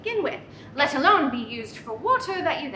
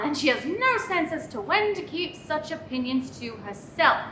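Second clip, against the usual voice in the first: ordinary delivery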